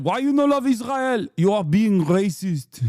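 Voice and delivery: put-on loud, high hammy German accent; mock a German accent; bad German accent. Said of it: "like, a bad German accent".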